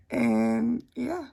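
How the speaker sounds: talking with a swollen tongue; words thick from a swollen tongue. Real, not acted. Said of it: "my tongue is swollen".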